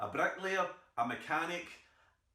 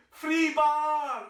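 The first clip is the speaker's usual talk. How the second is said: "Free bar!" roared much higher and much louder; Wallace battle cry; 13 semitones higher, 10 dB louder than usual. Retold or imitated. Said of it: "He shouted".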